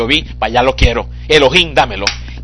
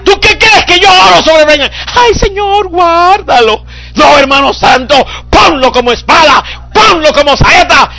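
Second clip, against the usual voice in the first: much higher and louder, crying out; preaching at full force; 8 dB louder than usual. Acted. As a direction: shout the verse over the congregation. (predicación a todo pulmón, mucho más aguda)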